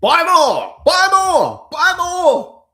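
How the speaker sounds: hyped trader yelling at viewers to buy; hyped-up urging. Acted. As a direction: shout it like a hype man. Urging buying in dip.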